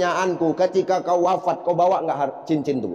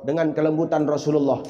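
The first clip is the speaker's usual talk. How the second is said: ordinary talk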